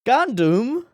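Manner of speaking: a sudden high Gundam, delighted surprise; surprised question. Real, not acted. Spots the name on screen.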